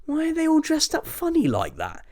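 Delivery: put-on high, puzzled young voice; bemused teen lad asking; curious Milton. A narrator's reading. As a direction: ask it wide-eyed and baffled. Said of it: "queried Milton".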